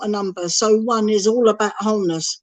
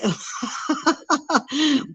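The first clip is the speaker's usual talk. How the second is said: laugh; 4 semitones higher, 6 dB quieter than usual. Real, not acted. Laughs at own stubbornness.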